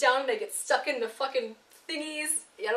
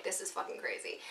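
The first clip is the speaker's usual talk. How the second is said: breathy whisper of disbelief; hushed, unvoiced aside to yourself; 3 semitones lower, 9 dB quieter than usual. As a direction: whisper it under your breath. I was still like.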